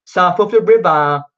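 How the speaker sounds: playing up the London Cockney twang; Cockney accent. Shown as a demonstration. Viewers ask about his accent, so he hams up Cockney. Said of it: "that sort of Mockney accent".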